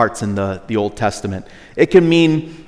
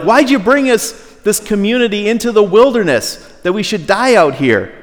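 whining accusation; 7 semitones higher, 3 dB louder than usual. Retold or imitated. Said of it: "they're like".

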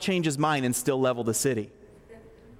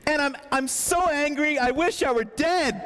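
yelling Jonah; 9 semitones higher than usual. Acted. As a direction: yell it in Jonah's despair. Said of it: "I'm yelling too much".

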